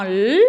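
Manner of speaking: "l" held long as "lll"; exaggerated letter sound. Shown as a demonstration. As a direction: linger on the "l", never "elle". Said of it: "on exagère un petit peu quand on prononce". (« l » tenu longtemps)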